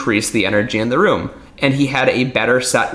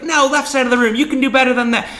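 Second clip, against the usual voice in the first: hype host; 13 semitones higher, faster than usual. Retold or imitated. Magician mocking a hype host's opener, pumped-up emcee voice. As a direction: work the crowd like a cheesy entertainer. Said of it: "I'd be like".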